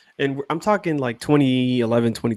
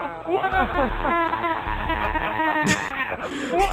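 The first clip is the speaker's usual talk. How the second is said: long high laugh; 6 dB quieter than usual. Real, not acted. Host reacts to clip.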